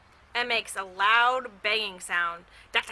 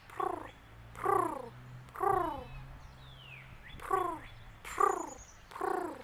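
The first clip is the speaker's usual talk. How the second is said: higher, soft cooing purrs; higher pigeon coos, each one dropping; 7 semitones higher, 8 dB quieter, much slower than usual. A narrator's reading. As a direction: coo like a pigeon, falling on each purr. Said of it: "They say".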